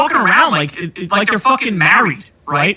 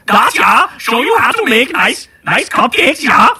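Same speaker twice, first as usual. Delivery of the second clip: higher, chirpy fake German accent; mock German hostess; 4 semitones higher than usual. Host parodies Goebbels's wife on TV, chirpy German accent. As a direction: play a cheery TV homemaker with a cartoon German accent. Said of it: "like, the wife of Goebbels being on Nazi T V".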